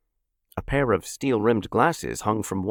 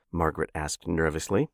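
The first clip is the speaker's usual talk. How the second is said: ordinary delivery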